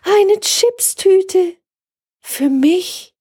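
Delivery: very high, slow astonished call; astonished cry. A narrator's reading. Hotel guest finding chips in her room, high astonished cry. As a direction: gasp it out in wonder. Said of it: "rief erstaunt".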